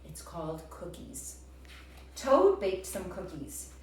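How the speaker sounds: ordinary delivery, nothing marked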